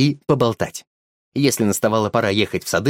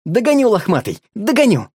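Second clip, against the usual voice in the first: playful chase call; 8 semitones higher than usual. A narrator's reading. Boy chases the dog again, playful call. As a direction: call out as you run.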